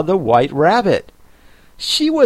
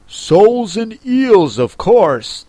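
impatient; 8 semitones higher, 4 dB louder than usual. A narrator's reading. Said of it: "replied rather impatiently".

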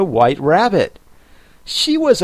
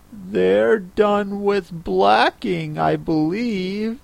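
put-on soft slow girl voice; 7 semitones higher, 3 dB quieter, slower than usual. Alice voiced.

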